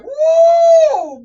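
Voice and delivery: long, loud high-pitched "woo"; high warning whoop. Retold or imitated. Warning someone serving up disrespect, loud whooping. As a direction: let out a big drawn-out whoop.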